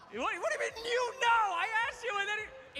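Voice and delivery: baffled disbelief. Real, not acted. Host baffled by contestant's answer.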